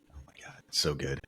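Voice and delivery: usual speaking voice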